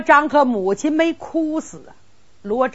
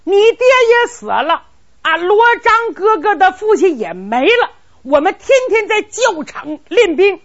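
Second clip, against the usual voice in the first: higher, louder urgent shout; excited shouting; 9 semitones higher, 5 dB louder than usual. Acted. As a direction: Cheng Tong shouts to rouse Qin Ying. Friend urges him to war. (激动地高声喊)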